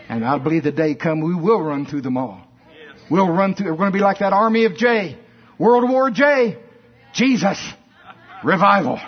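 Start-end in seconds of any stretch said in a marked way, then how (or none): 3.1-9.0 loud, high rallying cry; preacher roaring World War J; preaching shout. Real, not acted. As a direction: whip the crowd up for revival. Preaching climax.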